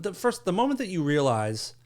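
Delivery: ordinary delivery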